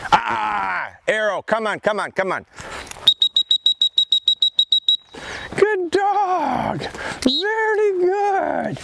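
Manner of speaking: very high, slow, stretched good dog; lavish the puppy with very high praise. Real, not acted. Calling Arrow back, then praising.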